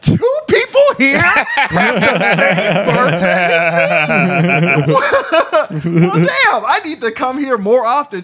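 slow, laughing amazed shout; cracking up at the coincidence; real laughter through the words; laughing disbelief. Real, not acted.

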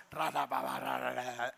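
a possessed man snarling at the wall, mock; imitating the possessed growl. Imita al endemoniado gruñendo. (imitación grave de gruñido de poseído)

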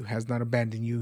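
ordinary talk, nothing marked

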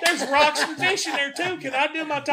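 very high-pitched exasperated pleading; comic exasperation. Retold or imitated. Tour guide begging to continue his talk, comic exasperation. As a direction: plead in a strained high mock outburst.

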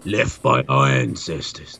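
slow, low Aussie pig voice; Australian accent. Pretend. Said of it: "it has an Australian accent".

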